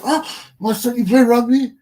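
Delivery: pa amazed at his son; surprised father. Acted. Acting out pa reading newspaper.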